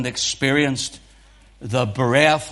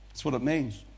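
normal talking voice

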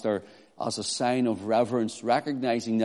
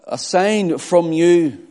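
"A sign from you" slow, loud and higher; emphatic; 4 semitones higher, 6 dB louder, slower than usual. Acted. Pharisees demanding a sign from Christ, slowly emphatic. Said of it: "it's emphatic".

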